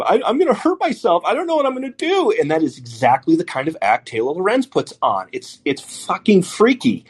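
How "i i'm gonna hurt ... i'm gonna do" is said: high, fast, pleading panic. Retold or imitated. Stephen Glass begging his editor, high panicky plea. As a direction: beg him not to fire you, voice up high. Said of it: "Stephen Glass starts pleading with him".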